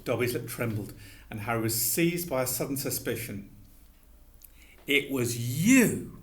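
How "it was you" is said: slow, dawning "It was you"; slowly. A narrator's reading. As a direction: let the realisation land word by word. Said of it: "he said slowly".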